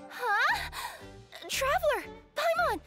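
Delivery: high, astonished cries; startled. Acted. Sleeper waking to find her friends, startled. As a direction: do a sudden double take.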